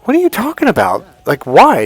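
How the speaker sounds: high, loud baffled challenge; retold incredulity. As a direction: demand an explanation, voice jumping up. Retold argument with girlfriend.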